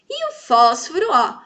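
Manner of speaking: usual speaking voice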